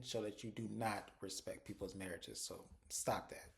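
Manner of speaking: half-whispered, slow telling-off; hushed aside. Real, not acted. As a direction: drop to a whispered scold, slowly. [name] scolds Belinda as an aside.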